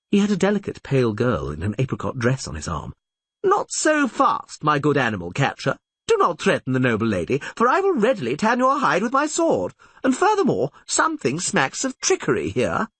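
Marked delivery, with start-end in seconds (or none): 3.4-12.9 put-on higher, lofty young squire's voice; a chivalrous squire showing off; gallant young squire. Squire defending the lady, high and lofty.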